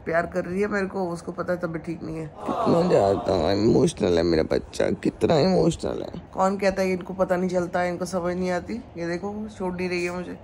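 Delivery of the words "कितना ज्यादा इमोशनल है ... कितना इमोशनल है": gushing tenderness. Real, not acted. Gushing over her emotional pet.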